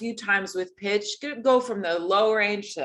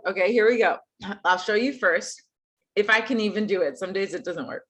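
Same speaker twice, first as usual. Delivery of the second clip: ordinary delivery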